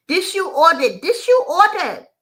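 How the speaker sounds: high, loud mock yell in broken English; loud nasty yell. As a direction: hammer it at him like the furious waitress. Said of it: "In a real loud, nasty tone, she yells".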